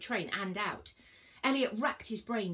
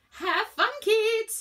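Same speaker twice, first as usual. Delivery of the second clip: high, loud gleeful send-off; jolly, loud and high-pitched farewell; laughed out; 10 dB louder, much slower than usual. A narrator's reading. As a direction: call it out with mocking laughter in the voice. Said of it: "he laughed".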